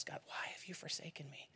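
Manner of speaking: whispered, broken plea to God; whispered cry from the cross. Acted. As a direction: breathe the words like a last plea.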